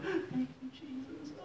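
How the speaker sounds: high, hushed tearful thanks; softly squeaked, overcome with mock emotion; mock tearful praise. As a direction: imitate weepy worshippers in a small high voice. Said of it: "crying, they're thanking God".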